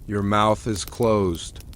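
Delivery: plain reading voice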